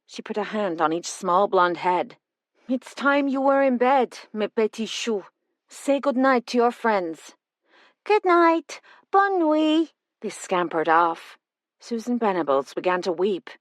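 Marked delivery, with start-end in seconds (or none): 2.7-7.3 put-on gentle French accent, coaxing children to bed; a French nanny tucking the girls off. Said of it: "in a French accent". 8.0-9.9 put-on high, sing-song children's good night; small twins saying good night; little girls.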